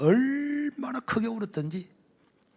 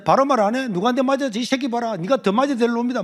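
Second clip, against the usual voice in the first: much louder, higher, fast; furious teacher; 9 semitones higher, 6 dB louder, faster than usual. Retold or imitated. Teacher hitting the student's head, furious shout. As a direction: threaten him in a shout.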